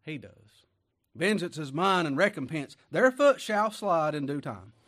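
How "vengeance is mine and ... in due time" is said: full-force preaching. Acted. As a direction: thunder the verse out to the pews. Preacher quotes scripture loudly.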